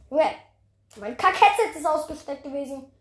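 usual speaking voice

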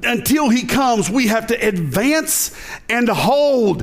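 high, ringing sermon climax with stretched key words; impassioned preaching. Real, not acted. Pastor urging church to advance until He comes, impassioned preaching. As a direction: drive the charge home, high and drawn out.

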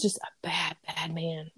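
whispered, dropping to near silence; hushed whisper. Real, not acted. Host whispers her verdict on the cult leader. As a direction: breathe it out as a grim whisper. Grim remark about the offender.

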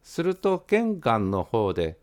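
ordinary delivery

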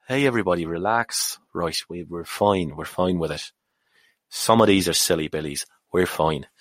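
put-on calm everyone down in an easygoing Irish accent. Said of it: "comments from Irish people going".

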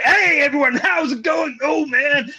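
loud, high-pitched stoner guru greeting; Kevin Smith. Retold or imitated. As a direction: shout it out as a hammed-up stoner spiritual leader. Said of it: "channeled my inner Kevin Smith".

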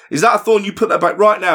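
high, loud telling-off; scolding mother. Acted. Mummy telling Timmy off about the thorn, high scolding voice. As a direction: snap at him like a cross mum. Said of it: "She's like".

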